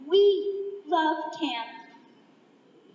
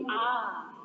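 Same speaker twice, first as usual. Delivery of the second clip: low, softer ah; demo ah; 6 semitones lower, 4 dB quieter than usual. Shown as a demonstration. Speaker teaches the other side its cue, low ah. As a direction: model a deep settling ah. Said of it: "will you please say".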